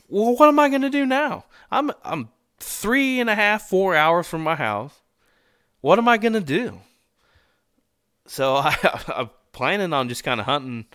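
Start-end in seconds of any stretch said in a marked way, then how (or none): 0.1-6.8 dismayed self-quote. Retold or imitated. Hunter recalling being hours from home, high-pitched dismay. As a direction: replay your own helpless worry, pitched up. Retelling his dismay when stranded.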